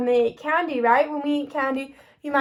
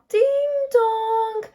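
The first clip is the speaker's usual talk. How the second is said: doorbell chime; 8 semitones higher than usual. Acted. Doorbell and kids greeting.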